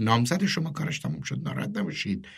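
low, very soft murmur; under the breath. A narrator's reading. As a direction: murmur it low, barely voiced above a mutter. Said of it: "زیر لب گفت". (زیر لب، آهسته و بم)

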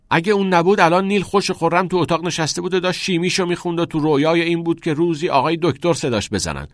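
loud, high, rushed blaming; scornful argument. A narrator's reading. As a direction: lay the blame heatedly. Scornful blame in heated quarrel.

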